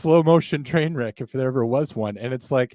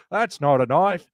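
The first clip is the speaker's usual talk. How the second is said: mock Australian accent, slowing on the second line; Crocodile Dundee; slower than usual. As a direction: do a broad Aussie movie-hero accent. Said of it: "uh, Crocodile Dundee".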